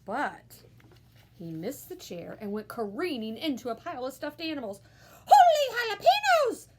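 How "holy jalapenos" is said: high, loud startled cry; a high, loud kitten exclamation; exclaiming. A narrator's reading. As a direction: yelp it in surprise. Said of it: "exclaimed Skippy John Jones".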